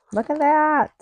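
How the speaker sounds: high, delighted and slow; thrilled it worked out; excited. Real, not acted. Tape turned out enough.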